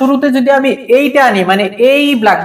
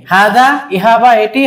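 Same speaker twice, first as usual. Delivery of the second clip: normal talking voice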